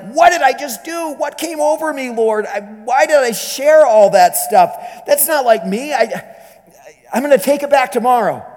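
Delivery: a hint of a frightened woman doubting herself alone; panicked second thoughts. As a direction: rush it out in rising panic. Fears return in the cell.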